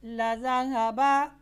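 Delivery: slow chanted tajweed recitation; Quran recitation. Acted. Teacher recites the verse for students, slow and chanted. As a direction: intone the verse slowly, melodically.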